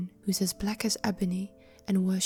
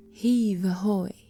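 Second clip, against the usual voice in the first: slow, louder hauling call; sailors' shout; 6 dB louder, slower than usual. Acted. Sailors hauling chests call out, loud slow heave. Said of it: "they shouted".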